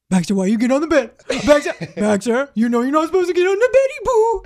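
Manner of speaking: mock scolding in baby talk; scolding doggy voice. Owner scolding dog for the bed, playful baby talk. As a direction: tell the dog off sweetly. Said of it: "I'll be like".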